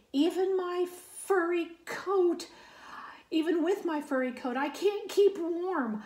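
high, pleading little bear voice; Polar Bear's letter voice. Acted. Reading Polar Bear's letter to Panda, high and pleading. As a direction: raise the voice high and sound helpless in the cold.

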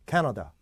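캐나다 with strong 캐 and blurred "nuh-duh"; native-like pronunciation. Shown as a demonstration. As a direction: stress 캐, reduce 나다 to schwas. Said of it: "저는 어떻게 해요?".

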